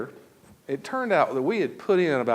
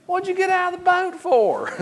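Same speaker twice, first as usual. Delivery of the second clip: mocking Terry; 5 dB louder than usual. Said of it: "here comes Terry".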